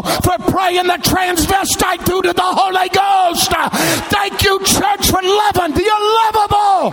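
high, shouted pulpit cry with drawn-out words; full-force preaching. Real, not acted. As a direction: preach it out at the top of your range.

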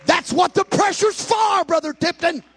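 high, loud preacher shouting to the church; full-force preaching. Acted. Preacher shouting to the congregation about the Holy Ghost, full force.

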